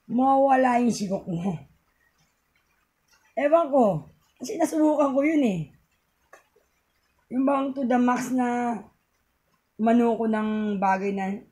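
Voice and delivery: normal talking voice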